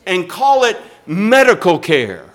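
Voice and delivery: loud, building indignation; righteously vexed. Real, not acted. Preacher upset about abortion, righteously indignant. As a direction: build to a forceful, outraged preaching cry. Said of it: "it vexes my righteous soul".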